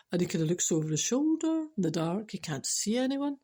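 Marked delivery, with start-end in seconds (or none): none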